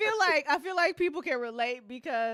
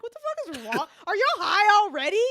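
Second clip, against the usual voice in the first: higher-pitched mock disbelief; baffled, pretend-annoyed questioning; incredulous demand; 7 semitones higher, 6 dB louder than usual. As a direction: act out walking in on stoned friends. Voices David walking up, incredulous.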